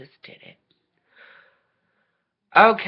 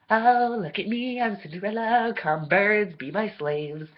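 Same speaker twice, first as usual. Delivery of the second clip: mocking Cinderella; 6 semitones higher than usual. Cinderella ordering the mice and birds, high mocking princess. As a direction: lord it over the birds, sweetly smug.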